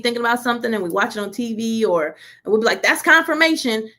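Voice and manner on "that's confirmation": higher, louder burst of certainty; mock eager exclamation. Preacher mimicking people who watch TV, mock eager. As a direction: mimic someone declaring it triumphantly.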